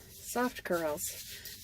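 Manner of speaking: hushed, slow murmur of the words; soft murmur. Real, not acted. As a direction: breathe it softly, wishfully. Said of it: "That's all I want is soft curls".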